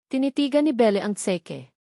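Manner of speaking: plain reading voice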